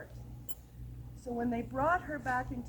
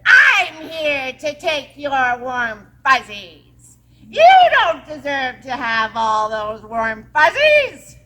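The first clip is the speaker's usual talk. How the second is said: slow, loud menacing witch voice; the witch demanding the children's warm fuzzies, slow and loud; 15 dB louder, slower than usual. Acted. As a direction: drag out each threat like a greedy witch. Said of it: "the witch said".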